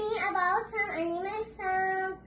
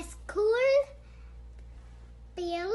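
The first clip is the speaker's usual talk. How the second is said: ordinary talk, nothing marked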